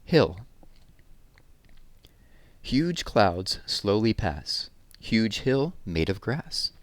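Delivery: put-on lively, lilting read-aloud to children; poem reading. A narrator's reading. Teacher reading a summer poem to children, lively and lilting. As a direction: savour each image, bright and rhythmic.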